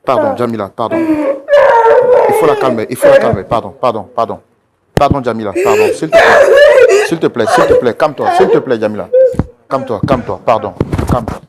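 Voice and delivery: loud, urgent repeated begging; frantic pleading. Real, not acted. Begging Jamila to forgive and calm down, frantic pleading. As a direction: shout pleas over the commotion to calm her. Restraining and begging distraught woman.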